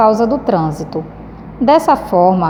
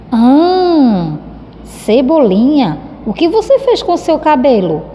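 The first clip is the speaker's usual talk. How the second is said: surprised question; 4 semitones higher, slower than usual. Shown as a demonstration. Teacher reading Mônica asking about the haircut, surprised. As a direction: read it as a shocked friend noticing a haircut.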